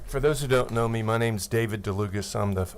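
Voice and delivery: ordinary talk, nothing marked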